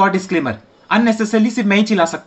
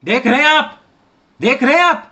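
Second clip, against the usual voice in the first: mocking host; 8 semitones higher than usual. Host to viewers, taunting the silent guest, mocking.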